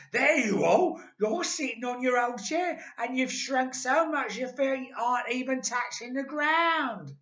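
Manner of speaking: loud, high triumphant shout; Mister Twit shouting. A narrator's reading. Husband shouting at shrinking wife, triumphant. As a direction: point and crow at her. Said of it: "Mister Twit pointed at her and shouted".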